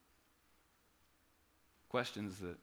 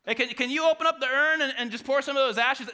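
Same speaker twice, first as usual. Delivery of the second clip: mock rude request; 8 semitones higher, 6 dB louder, faster than usual. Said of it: "he's like".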